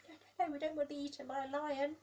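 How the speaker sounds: fast, high, loud pretend fright; mock panic. Reader pretending to fear the lion, fast mock panic. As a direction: gabble it as if fleeing the lion.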